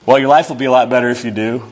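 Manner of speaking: quick, salesy pitch; pushy pitch. Retold or imitated. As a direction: rush the sell like a pushy evangelist. Salesy evangelist in acted exchange.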